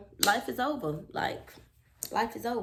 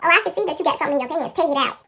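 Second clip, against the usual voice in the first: loud, high, rapid mom scolding; accusing mother; 9 semitones higher, 11 dB louder, much faster than usual. Retold or imitated. Mom spots something hidden in pants, scolding. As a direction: imitate a fed-up mother demanding it.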